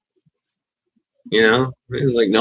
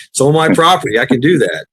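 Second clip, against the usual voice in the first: ordinary talk, nothing marked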